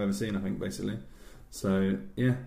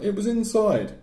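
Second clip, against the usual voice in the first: put-on high-pitched child voice; mock childish wail; upset little sister; 12 semitones higher, 4 dB louder than usual. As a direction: answer high like a tearful child. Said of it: "She's like".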